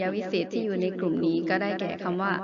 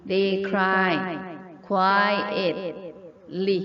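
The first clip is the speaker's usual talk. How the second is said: slow careful version; much slower than usual. Shown as a demonstration. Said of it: "นี่แบบช้าๆเนาะ".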